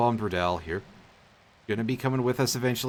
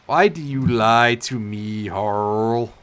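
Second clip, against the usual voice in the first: slow, louder goofy put-on accent; goofy Starkey accent; 6 dB louder, much slower than usual. Voicing Starkey to Harl in a slow goofy accent. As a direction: drag the words out in a silly cartoon accent. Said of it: "Starkey's goofy accent".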